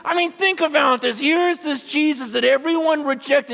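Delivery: high, amazed sermon cry; impassioned preaching. Real, not acted. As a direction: burst out in marveling fervor. Preaching at full force.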